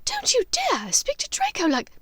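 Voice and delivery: high, cut-off outburst; angry mother. Acted. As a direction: flare up protecting your son. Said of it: "Narcissa flared in anger".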